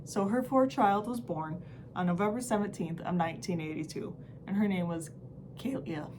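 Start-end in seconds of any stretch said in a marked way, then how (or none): 5.6-6.1 voice cracking. Real, not acted. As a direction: voice cracks on the name, softer. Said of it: "Sorry, my voice cracked".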